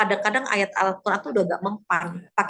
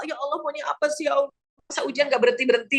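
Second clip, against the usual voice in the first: exasperated plea; 5 semitones higher, faster than usual. Retold or imitated. A believer tested too long, complaining to God. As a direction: sigh out a tired protest at the trials. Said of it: "kita nanya Allah".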